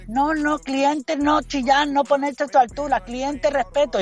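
high, clipped scolding boss voice. Retold or imitated. Waiter imitating boss scolding him, high pitched. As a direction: lecture him in a high squeak. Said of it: "la china recriminándome".

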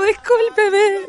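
high, slow pleading sorry; drawn-out apology. Retold or imitated. To the bus driver, a slow high pleading apology. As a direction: stretch it out, begging pardon.

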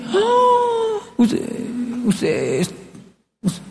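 high drawn-out gasp, then stammering; mock shocked gasp. Latinos hearing someone confess, mock-scandalized stammer. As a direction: gasp in exaggerated shock, then lose the words. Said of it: "Y los latinos decimos".

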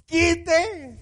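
a lightly voiced Jesus, high and drawn out; Jesus commanding. Jesus orders the stone removed, high drawn-out command. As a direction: give the order as Jesus, stretched. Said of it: "Dijo Jesús".

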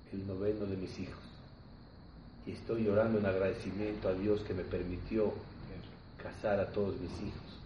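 low, hushed acted confession; subdued, heartfelt father's reply; humbly grateful. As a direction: drop the voice low and soft, giving thanks. Weeping father tells of his gratitude.

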